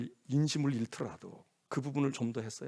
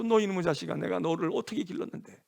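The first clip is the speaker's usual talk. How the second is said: loud, raised-pitch reproach; parent's yell; 5 dB louder than usual. Acted. Parent shouting at their erring child, exasperated and hurt. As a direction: cry out in exasperation at a wayward child. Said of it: "소리를 질러서라도".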